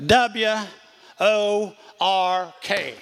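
W O R K spelled letter by letter, last letters drawn out slow; spelled out slowly. Retold or imitated. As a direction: spell out W O R K slowly like a stern college president. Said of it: "Let me tell you how you spell ministry".

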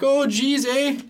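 very high, exaggerated Canadian accent; thick Canadian accent. Shown as a demonstration. Showing the thick Canadian accent from the movie. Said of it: "they speak in a very thick".

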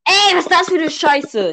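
shocked outcry. Real, not acted. Friend reacts to test result.

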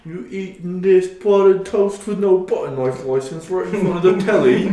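loud, high British cop voice; mock British accent. Friend playing knife police, in a loud high British accent. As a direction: demand it like a pompous British officer.

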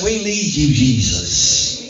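loud rallying cry to Jesus; hollered call. Shown as a demonstration. Preacher leads congregation calling on Jesus, hollering out. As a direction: holler it out for everyone to follow. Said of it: "Everybody holler out and say".

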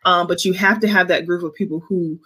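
usual speaking voice

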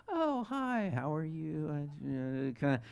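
meek, hushed face-to-face greeting; sheepish mumble, very soft. Acted. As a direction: shrink into a small, soft voice. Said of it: "kinda a little softer when you're present".

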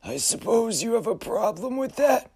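high uncanny challenge; otherworldly figure daring him; eerie high voice. Acted. Frederick challenges.

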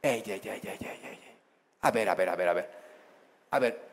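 very soft, low interruption; calm parent. Acted. Father steps into children's fight, calm and hushed. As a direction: quietly break up the fight. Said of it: "Llega un padre, un hombre de Dios".

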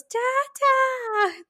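high-pitched, drawn-out squeal for daddy; imitated toddler scream. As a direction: mimic a baby screaming in excitement. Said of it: "literally screams and goes".

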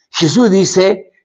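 normal talking voice

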